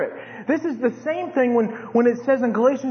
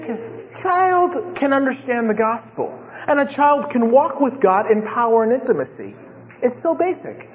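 ordinary talk, nothing marked

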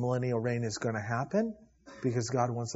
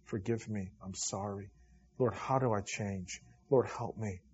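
much softer, a humble murmured prayer; quiet repentant prayer; 5 semitones lower, 7 dB quieter than usual. Retold or imitated. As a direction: pray it quietly, contrite. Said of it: "And I say".

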